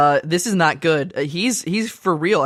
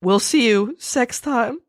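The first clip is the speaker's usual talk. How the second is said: slow sign-off voice; 4 semitones higher, much slower than usual. Acted.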